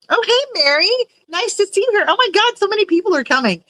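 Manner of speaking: high-pitched, delighted flurry; excited greeting. Real, not acted. Streamer greets Mary as many people arrive, excited high hello. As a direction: squeal a hello, thrilled at the crowd.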